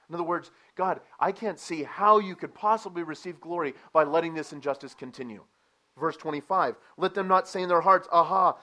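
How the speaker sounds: loud, high, urgent preaching; full-force preaching. Acted. Preacher voicing David's desperate prayer to God, loud. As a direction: cry the prayer out to the congregation.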